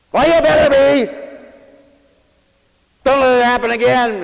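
loud, high scolding parent; stern parent. Retold or imitated. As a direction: warn the child sternly. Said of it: "Oh, you might say".

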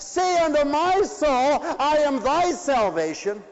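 high, loud proclaiming of the verse; ringing preached plea. Acted. Preacher to congregation, loud preached plea. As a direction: call the verse out to the hall.

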